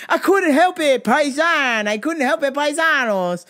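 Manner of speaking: loud, high mock Jersey accent. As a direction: ham up the Jersey paisan. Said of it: "fake Jersey accent".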